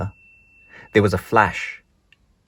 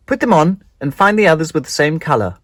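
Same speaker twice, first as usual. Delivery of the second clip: a lightly voiced teacher, a bit higher and louder; 7 semitones higher, 7 dB louder than usual. Teacher telling the children to count themselves, lightly voiced. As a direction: call out to the class like a teacher.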